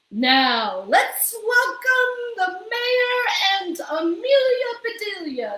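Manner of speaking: high, loud sung announcement; announcer introducing the debaters, sung and loud. A narrator's reading. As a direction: sing out the welcome like an emcee. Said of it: "said the announcer".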